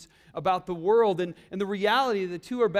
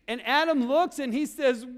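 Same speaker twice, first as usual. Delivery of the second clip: ordinary talk, nothing marked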